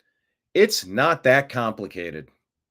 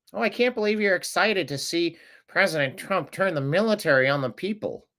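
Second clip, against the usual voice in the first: high mocking sneer at the critics; mocking whine; 7 semitones higher than usual. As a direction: mimic a scandalized critic, pitched high.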